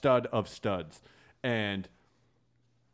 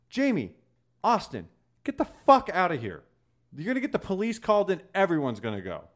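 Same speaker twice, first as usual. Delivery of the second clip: high, louder order to leave; angry order; 9 semitones higher, 5 dB louder than usual. Retold or imitated. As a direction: send them off firmly, raised voice. Ordering them off his property.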